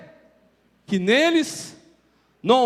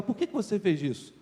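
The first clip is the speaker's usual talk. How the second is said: put-on much softer, calm question to a child; soft, patient parent's question; gentle question; 2 semitones lower, 7 dB quieter than usual. Shown as a demonstration. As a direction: kneel and ask quietly. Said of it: "Ajoelhe, olhe nos olhos da criança e fala".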